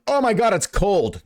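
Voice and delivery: imitating a high, slow yelp at cold water; hammed-up gasp of shock under a cold shower; retelling himself in a cold shower, a high mock yelp. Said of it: "I'm like".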